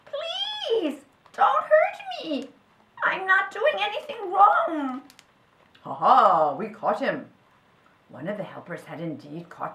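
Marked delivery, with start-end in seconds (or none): 0.1-5.0 very high, loud pleading; tiny creature begging for mercy; squeaky, desperate protest; crying badger. A narrator's reading. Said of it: "cried the badger tea kettle". 5.8-7.3 loud, gloating ha ha; triumph. A narrator's reading. Helper triumphs after the catch.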